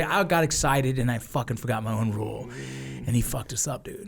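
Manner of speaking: ordinary talk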